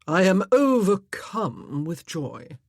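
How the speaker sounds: slow, bored sigh of a voice; sighing with ennui. Acted. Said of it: "his voice sighing with ennui".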